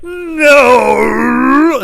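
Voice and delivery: loud, high, stretched-out growl of refusal; mock enraged outburst. Employee suddenly snapping at the customer, enraged outburst. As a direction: let one word erupt in mock fury.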